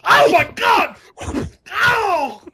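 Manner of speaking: high, loud cartoon shriek; mock scream. Quoting the cartoon beating scene, high mock scream. Acting out Family Guy beating.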